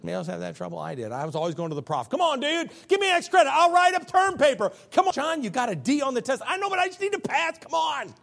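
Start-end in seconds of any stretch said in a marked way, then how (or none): 2.1-8.2 high, loud mock pleading with the professor; begging student. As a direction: whine for extra credit, half-shouting.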